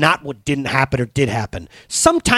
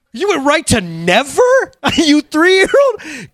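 high, loud disbelief; mock incredulity; 6 dB louder, slower than usual. Dad reacts to three-year-old's refusal, loud mock outrage. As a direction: exclaim like a flabbergasted dad, stretching the ending.

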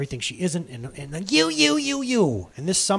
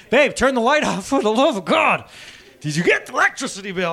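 lightly voiced nagging wife, a bit higher and louder; husband mimicking his wife's nagging; 5 semitones higher, 6 dB louder than usual. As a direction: imitate the wife's complaining. Voicing wife's complaints.

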